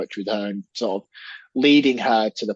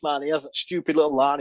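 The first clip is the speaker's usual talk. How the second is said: normal talking voice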